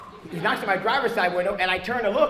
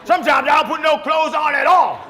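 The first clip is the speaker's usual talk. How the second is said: loud, proud bellow; mock boastful shout; 7 semitones higher, 8 dB louder than usual. Comic playing the hunter, loud boasting. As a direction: brag it to the whole room.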